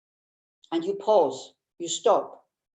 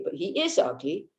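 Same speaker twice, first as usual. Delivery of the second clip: ordinary talk, nothing marked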